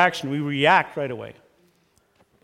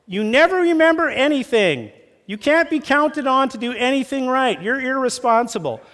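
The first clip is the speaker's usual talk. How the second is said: higher, louder accusing spouse; mock criticism; 9 semitones higher, 4 dB louder than usual. Spouse attacking partner's character, high accusing mock. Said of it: "Criticism is more like".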